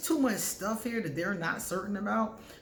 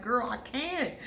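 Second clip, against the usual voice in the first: laughing reply; 6 semitones higher, slower than usual. Retold or imitated. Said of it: "And I'm like".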